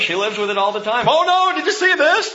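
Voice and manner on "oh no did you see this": high, loud alarmed squeal; mock alarm. Pastor telling his wife the news, high hammed-up alarm. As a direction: shriek it like the headline is the end of the world. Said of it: "Cheryl knows".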